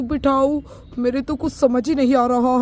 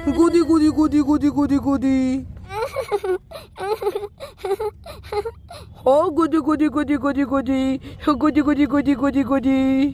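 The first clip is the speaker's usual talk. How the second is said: singsong tickle noises, playful; tickling baby talk; 2 semitones higher than usual. Acted. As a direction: coo at the baby while tickling her. Tickling baby to make her laugh.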